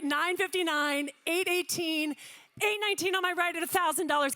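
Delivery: ordinary talk, nothing marked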